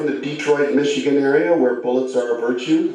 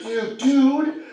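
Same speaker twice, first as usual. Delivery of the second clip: flustered pleading; 10 semitones higher than usual. Retold or imitated. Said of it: "I'm kinda just flustered".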